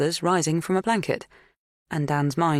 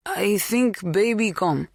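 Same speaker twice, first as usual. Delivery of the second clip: put-on slow, higher Polish-accented broken English; strong foreign accent; 5 semitones higher, slower than usual. A narrator's reading. Polish farmhand describing a pregnant woman, strongly accented. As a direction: explain slowly in a thick Polish accent. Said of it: "His accent was strong".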